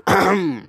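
pointed throat clearing; mock throat clear. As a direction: clear the throat to get attention. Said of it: "[name] clear her throat".

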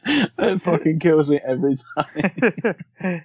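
can barely get it out for laughing; real laughter breaking up the line. Real, not acted. Laughing about favourite scene.

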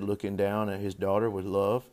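usual speaking voice

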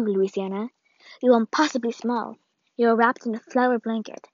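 plain reading voice

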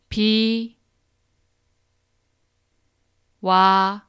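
비, 와, 요 each said apart with long pauses; syllable by syllable. Shown as a demonstration. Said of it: "break it into syllables so that you can repeat after me".

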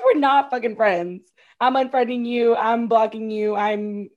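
loud, emphatic pretend telling-off; mock outrage. Imagining cutting off a bossy friend, mock outrage. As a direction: cut off an imagined friend, forcefully.

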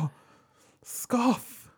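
high, haughty mock scoff. King snubbing the composer, haughty mock scoff. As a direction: sniff at him like a snooty king. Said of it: "And he was like".

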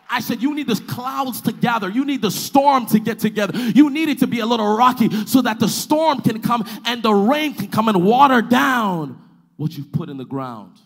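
loud, insistent pulpit build; full-force preaching. Real, not acted.

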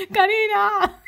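high, loud drawn-out yell; mock-dramatic scream; hammed-up name shout. Shouting a scene's line.

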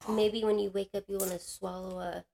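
imitating a sly prompt, voice dropping away; feed the words softly, as if off the record; quietly leading, slowing at the end; hushed suggestion. Intake lady feeds her the words.

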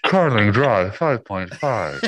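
slow robotic voice, laughing at the end. Acted. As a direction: drag out the numbers like a computer. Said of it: "get the Google voice to say it".

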